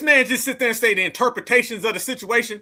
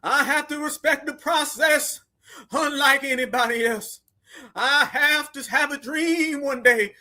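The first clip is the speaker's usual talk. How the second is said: mock civil-rights preacher, slowly stretched words; hammed-up, drawn-out oratory imitation; Martin Luther King; slower than usual. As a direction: parody a grand speech, slowly and ponderously. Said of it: "he went full Martin Luther King".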